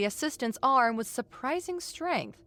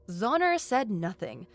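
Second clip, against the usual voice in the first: ordinary delivery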